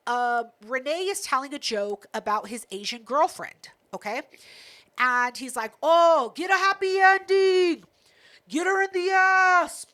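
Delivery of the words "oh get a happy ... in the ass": put-on higher, loud, slow drunken heckle; heckler yelling. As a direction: yell it like an obnoxious heckler. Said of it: "yelling stupid things".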